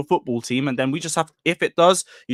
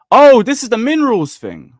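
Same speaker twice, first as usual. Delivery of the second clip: high, loud "oh" of recognition; excited realisation; surprised recognition; 9 semitones higher, 7 dB louder than usual. Real, not acted. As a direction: suddenly realise which clip it is. Recognises the requested clip.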